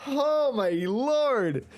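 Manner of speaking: jump-scared, breathless laugh; scared horror game streamer. Real, not acted. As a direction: shaken after a thunder jump scare. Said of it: "jump scared me".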